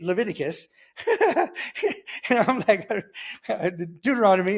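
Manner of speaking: words broken up by laughter; laughing. Real, not acted. New reader reaching Leviticus, helplessly amused. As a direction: crack up while naming the books.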